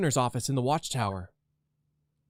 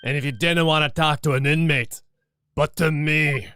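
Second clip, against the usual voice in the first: put-on Scottish accent; 3 semitones higher, 6 dB louder than usual. Oh, he's Scottish.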